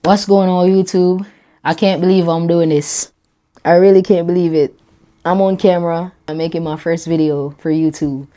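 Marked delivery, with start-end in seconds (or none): none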